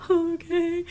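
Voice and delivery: high-pitched weepy surrender; drawn-out tearful squeak; mock tearful. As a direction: give in with a little wail. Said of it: "And so I cried".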